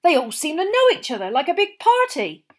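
the owner grumbles to Mr Weasley about odd campers; grumbling campsite owner. A narrator's reading. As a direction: grumble about the weirdos on your field. Owner continues.

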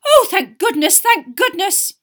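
very high, loud cry of relief; relieved cry. A narrator's reading. Mother spotting her family in the lane, crying out. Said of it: "a cry echoed along".

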